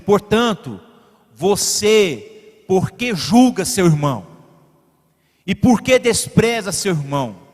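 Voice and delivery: normal talking voice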